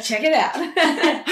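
slow, high, giggly "Check it out"; laughing show-off. Retold or imitated. Mother laughing as she shows her sister her chest. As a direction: laugh while bragging.

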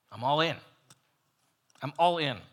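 loud, fervent declaration; a bold disciple's pledge; eager vow. Acted. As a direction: declare it like you're all in. Peter's eager vow retold.

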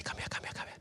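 hushed, repeated beckoning; quick, secretive whispered call. Shown as a demonstration. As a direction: whisper to coax a child closer. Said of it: "I'll begin to whisper".

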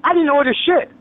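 high, indignant outburst; indignant denial. Real, not acted. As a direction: protest it with pitch climbing high. Angry denial, echoing the callers.

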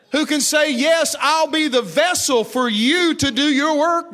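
full-force preaching. Acted. Preacher calling men and women to say yes, high and loud. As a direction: call the people out with force.

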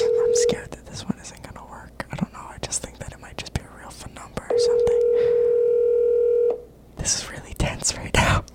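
nervous whisper. Real, not acted. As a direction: whisper tensely, hoping not to be heard. Waiting nervously for scammer to answer.

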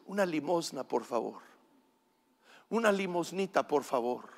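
soft, meek begging; súplica tímida. Retold or imitated. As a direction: ask small and low, trailing down. Voices a timid beggar's small request. (pidiendo limosna en voz bajita)

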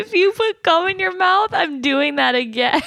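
high, laughing threat. Real, not acted. Teasing her friend back about the gum, laughing high. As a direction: squeeze the words out through laughter.

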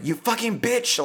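sudden high-pitched yell at the gang; mock angry yell. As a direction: snap out a furious curse. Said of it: "she just yells".

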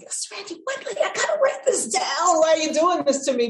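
high-pitched, hammed-up desperation; mock frantic protest. Fan retelling being forbidden to take notes, mock frantic. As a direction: plead in mock outrage, voice shooting up. Acting out own frustrated reaction.

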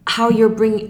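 ordinary talk

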